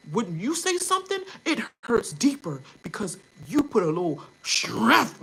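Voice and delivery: mocking be strong. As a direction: sneer the be-strong lecture. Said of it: "we're not supposed to show no emotion".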